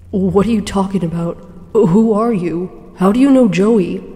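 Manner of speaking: demanding answers, voice rising; Henry confronting the ink figure, loud and urgent; loud questioning. Acted. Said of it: "I questioned it loudly".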